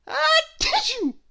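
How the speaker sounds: high, loud sneeze shaped as a word. Acted. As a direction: burst it out like a huge sneeze. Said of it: "who had started sneezing again".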